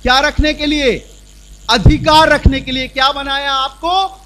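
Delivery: loud, high, ringing sermon; forceful preaching. Acted. Preacher tells the congregation what God made them, loud and fervent. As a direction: proclaim it triumphantly.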